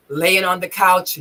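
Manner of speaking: ordinary delivery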